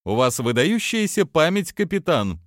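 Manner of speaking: high sweet chirpy voice; chirping. Acted. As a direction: chirp it like a cheerful princess. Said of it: "прощебетала Прайс".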